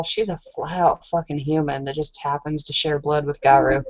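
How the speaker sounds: normal talking voice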